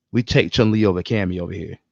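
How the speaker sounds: ordinary talk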